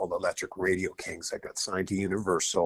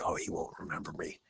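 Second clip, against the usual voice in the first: whispered thought; 4 semitones lower, 10 dB quieter than usual. Retold or imitated. Retold doubting thought at restaurant.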